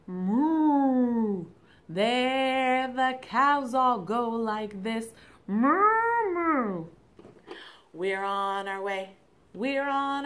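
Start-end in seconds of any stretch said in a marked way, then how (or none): 0.1-1.5 cow moo. Shown as a demonstration. 1.9-5.1 put-on reading to a toddler. Shown as a demonstration. 5.5-6.9 high loud mooing; cow moo. Shown as a demonstration.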